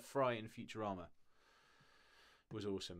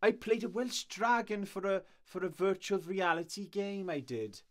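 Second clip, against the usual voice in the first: put-on deliberately terrible Welsh accent, pitched up; bad Welsh accent; 8 semitones higher, 7 dB louder than usual. Telling chat about her dragon role, hammed Welsh accent. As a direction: ham up a clumsy Welsh accent. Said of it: "I'm doing a terrible accent".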